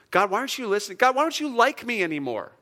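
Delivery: a hint of a hurt, pleading complaint; imitating a complaining psalmist. Psalmist complaining to God, lightly pleading. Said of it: "the psalmists say".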